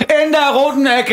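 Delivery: booming high ring announcer voice; boxing announcer. Pretend. Mock boxing ring introduction.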